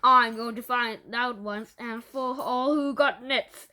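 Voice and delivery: slow, loud, menacing growl; a teacher growling threats at the class. A narrator's reading. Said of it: "she growled".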